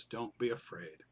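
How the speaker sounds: low, soft and calm reassurance. Retold or imitated. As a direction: soothe the frightened disciples gently. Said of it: "it's his calming voice that says".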